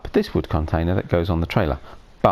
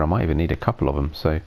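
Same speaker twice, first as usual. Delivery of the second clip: usual speaking voice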